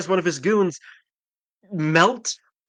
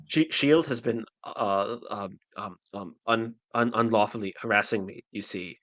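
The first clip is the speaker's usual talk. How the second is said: lightly imitated tech billionaire, low and halting; Elon Musk impression; 6 semitones lower than usual. Host voices the villain's complaint, light billionaire impression. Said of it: "are you doing your Musk impression right now".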